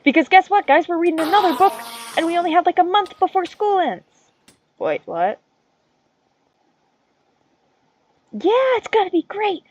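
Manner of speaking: teen mocking the new assignment with fake glee; sarcastic excitement. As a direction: oversell it with mock enthusiasm.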